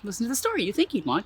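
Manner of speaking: ordinary delivery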